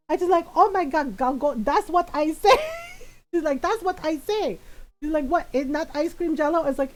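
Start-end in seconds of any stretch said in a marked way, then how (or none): 0.6-2.6 put-on mom's Filipino accent. Mom protesting she said so, in a loud Filipino accent. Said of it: "in my mom's voice". 3.6-4.6 put-on higher, indignant Filipino-accented mom; mom's Filipino accent. Mom defending her word, in her Filipino accent. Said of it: "in my mom's voice". 5.3-6.6 put-on puzzled Filipino-accented mom; mom's Filipino accent. Mom questioning the correction, in her Filipino accent. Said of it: "in my mom's voice".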